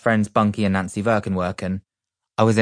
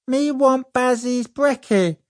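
put-on high, slow whiny toddler voice; little brother wails for the cereal; wailing toddler; 4 dB louder, slower than usual. A narrator's reading. Toddler wailing for his brother's breakfast. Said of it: "he wailed".

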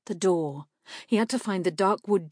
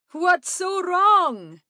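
high, loud, slow drawn-out call; loud hail, stretching the last word; 13 semitones higher, 8 dB louder, much slower than usual. A narrator's reading. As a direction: shout the question across to the group. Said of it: "Salamander called out".

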